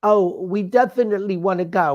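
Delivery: usual speaking voice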